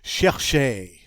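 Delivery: "Chercher" with both ch as sh; soft sh sounds. Shown as a demonstration. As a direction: model "Chercher" clearly, SHEHR-SHAY. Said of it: "all those sh sounds in it".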